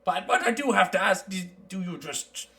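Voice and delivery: lightly voiced mountain dwarf; easygoing dwarf traveler.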